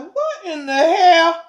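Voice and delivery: high, loud, drawn-out mock surprise; startled indignation. Old therapist answering a knock at ten at night, indignant. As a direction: stretch it out, acting appalled.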